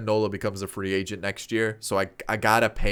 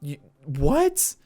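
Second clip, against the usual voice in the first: much higher, disbelieving rant; incredulous; 9 semitones higher, 4 dB louder than usual. Real, not acted. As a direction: react stunned at a rival's stacked roster. Rival team's stacked roster.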